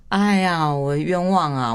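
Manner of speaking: loud, high, drawn-out wail of innocence; hammed-up "I've been wronged"; mock aggrieved protest. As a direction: plead innocence in playful exasperation. Comic protest at friends' doubt.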